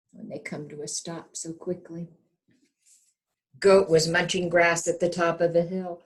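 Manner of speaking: ordinary delivery, nothing marked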